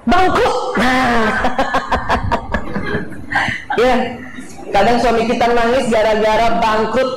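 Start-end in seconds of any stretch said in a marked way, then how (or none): 1.3-3.7 quiet, voiceless laugh; soft laugh. Real, not acted. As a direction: chuckle under the breath. Laughing at the topic.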